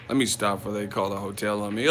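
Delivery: ordinary talk, nothing marked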